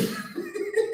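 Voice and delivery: a high giggle; high laugh. Real, not acted. Laughing at the post with her viewers, high giggle.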